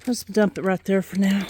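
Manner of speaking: ordinary talk, nothing marked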